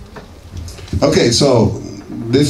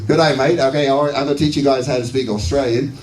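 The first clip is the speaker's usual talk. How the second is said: put-on imperfect Australian accent, raised pitch; 4 semitones higher, faster than usual. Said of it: "my Australian accent's not that good".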